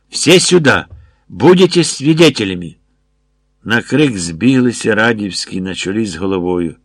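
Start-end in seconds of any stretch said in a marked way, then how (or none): 0.1-2.8 higher, louder call to bystanders; shouting. A narrator's reading. Major summoning witnesses after being attacked, shouting. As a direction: call everyone over with a raised voice. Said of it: "закричав Мішуліка".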